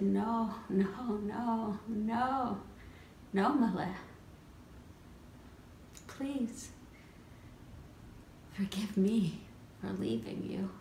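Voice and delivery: soft, gentle refusal turning to apology; tender father. Acted. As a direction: hush into a tender plea for forgiveness. Father gently answers, asks forgiveness.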